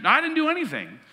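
high-pitched mock-innocent protest; imitating mom's denial. Son imitating mom denying she caused the computer problem, high mock-innocent. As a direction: squeak it out like a mom insisting she's blameless.

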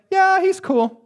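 high, offhand "yeah, he's cool"; mock-casual Peter; shrugging Peter. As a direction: toss it off like it's no big deal. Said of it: "Peter's saying".

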